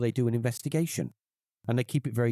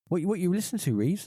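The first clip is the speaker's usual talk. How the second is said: lightly voiced mum, higher pitch; higher, questioning call up to your son; 9 semitones higher than usual. Mum coming up to ask what he's listening to, lightly imitated.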